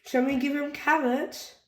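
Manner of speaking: Rebecca Rabbit. A narrator's reading.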